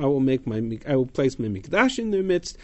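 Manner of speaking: usual speaking voice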